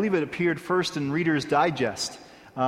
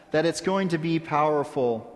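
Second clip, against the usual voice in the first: ordinary talk, nothing marked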